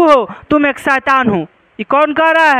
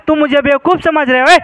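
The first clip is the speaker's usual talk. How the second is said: normal talking voice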